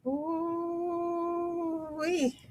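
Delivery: high, soft, long-held whoop; weary exclamation, high and quiet; drawn-out "ooh-wee". Real, not acted. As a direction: let a soft "ooh-wee" float up and fall. Worn-out exclamation after long week.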